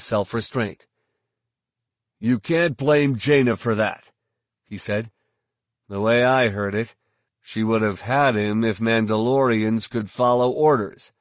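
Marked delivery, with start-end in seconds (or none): 2.2-4.1 loud. Acted. Father angrily defends Jaina. 5.9-11.1 loud. Acted. Father angrily defends Jaina.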